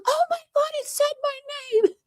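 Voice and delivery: high-pitched imitation of a chatter freaking out; excited squeal. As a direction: squeal it like a fan who just got noticed. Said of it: "He was like".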